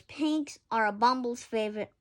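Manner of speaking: ordinary delivery, nothing marked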